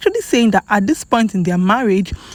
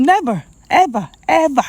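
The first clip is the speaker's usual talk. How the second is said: higher pitch on "ever, ever", each set apart; emphatic refusal; 6 semitones higher than usual. Retold or imitated. Quoting a wife's reply to critics, emphatic refusal. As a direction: punch "never, ever, ever" word by word.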